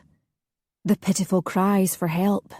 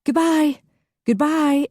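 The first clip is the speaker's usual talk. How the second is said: much higher, sing-song cheerful goodbye; 9 semitones higher, 3 dB louder than usual. A narrator's reading. The crowd calling goodbye to Alistair from the boat. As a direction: wave them off gaily. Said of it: "everyone said gaily".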